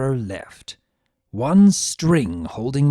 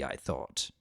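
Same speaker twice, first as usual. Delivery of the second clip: usual speaking voice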